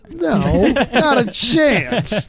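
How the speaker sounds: high loud slow no way; flatly refuse, drawn out and high; emphatic refusal. Real, not acted. Refusing to share email.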